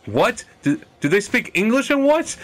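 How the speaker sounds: high-pitched mock movie tough guy; Pulp Fiction quote. Gamer quoting Pulp Fiction to a friend, high mock demand. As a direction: imitate a film interrogation, pitch leaping up. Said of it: "Pulp Fiction?".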